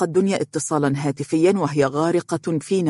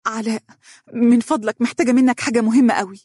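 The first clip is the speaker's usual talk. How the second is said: higher, uneasy request; flustered; 7 semitones higher, faster than usual. A narrator's reading. Mohra phones her husband for a favour, flustered. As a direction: ask awkwardly for a big favour. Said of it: "وقالت بارتباك".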